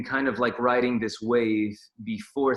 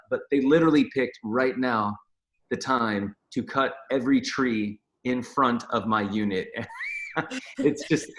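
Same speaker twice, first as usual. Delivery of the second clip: ordinary talk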